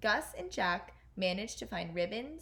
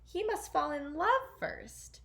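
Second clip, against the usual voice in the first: higher, slow, fussy duke; Grand Duke objecting; 7 semitones higher, 3 dB louder than usual. Acted. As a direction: plead with the king, slowly. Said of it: "said the Grand Duke".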